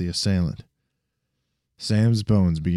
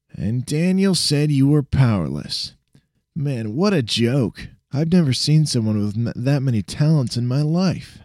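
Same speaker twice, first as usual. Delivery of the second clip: put-on higher, amused young sidekick; cocky friend voice; 6 semitones higher than usual. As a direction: tease him, impressed but playful.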